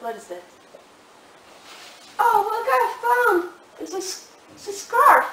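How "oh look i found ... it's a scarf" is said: high, loud discovery; agitated accusation. Acted. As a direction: announce the find in agitation. Wife produces more evidence.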